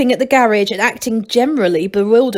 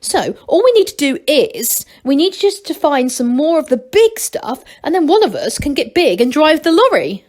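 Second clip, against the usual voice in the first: eager; 6 semitones higher, 4 dB louder than usual. A narrator's reading. Vinto eager plan.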